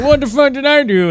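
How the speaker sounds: high, loud indignant cry; mock outrage. Friend voicing the grandfather's outraged reply. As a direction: protest it, outraged. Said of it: "he's like".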